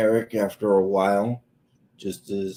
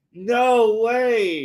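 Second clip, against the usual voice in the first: much higher, much louder, gleeful; delighted disbelief; 14 semitones higher, 5 dB louder than usual. Retold or imitated. Dad hearing his son smoked weed, thrilled. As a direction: cheer like you can't believe it. Dad's reaction repeated.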